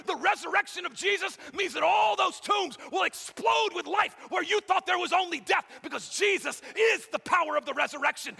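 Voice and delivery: loud, high, climbing sermon shout; full force preaching. Acted. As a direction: preach it out to the back row.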